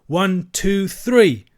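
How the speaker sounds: high count bursting loud on three; yelled count. A narrator's reading. Children yelling for help, yelled count. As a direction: build to a shouted last number. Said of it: "no mistaking the yell".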